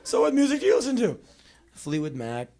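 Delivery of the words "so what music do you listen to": high, fast, loud question; nervous small talk. Acted. Boy filling silence with a girl, nervously eager. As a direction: blurt it out too eagerly.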